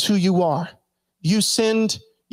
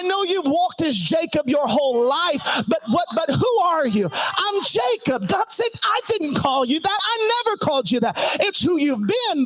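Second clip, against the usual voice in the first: high, shouted, impassioned preaching; preaching at full force; 8 semitones higher than usual. Acted. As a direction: hammer each line out at the top of your voice. Climactic preaching.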